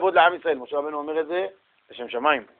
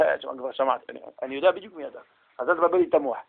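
dismissive reply; 4 semitones lower, 9 dB quieter, faster than usual. Retold or imitated. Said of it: "תגיד לך".

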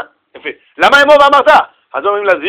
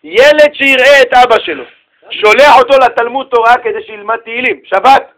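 ordinary talk, nothing marked

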